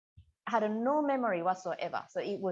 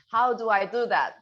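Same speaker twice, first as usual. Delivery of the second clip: laughing question; 2 semitones higher, 9 dB louder than usual. Retold or imitated. Grandmother laughs at odd gift.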